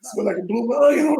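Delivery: high, giggling jab; mock insult said while cracking up; laughing roast. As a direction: tease through laughter. Roasting his younger self.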